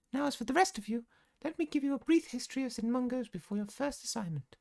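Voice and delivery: very high, fluting matron voice; gushing healer supervisor. Acted. Madam Strout welcoming students to the hospital, very high gushing voice. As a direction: coo at your little lambs in falsetto.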